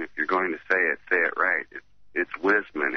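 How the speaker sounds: ordinary delivery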